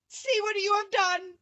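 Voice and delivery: a witch in terror; frightened scream. A narrator's reading. Melting witch crying out at Dorothy, terrified. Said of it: "she screamed".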